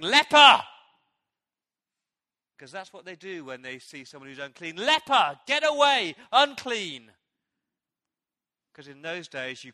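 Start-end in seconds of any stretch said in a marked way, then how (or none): 0.0-0.6 a full, high shout of warning. Retold or imitated. Crowd shouting at the leper to keep away, screaming. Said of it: "the people are shouting". 4.8-7.1 loud, high warning yell; shouting. Retold or imitated. Crowd yelling at the unclean man, loud shout. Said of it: "the people are shouting".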